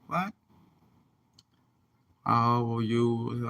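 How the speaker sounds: normal talking voice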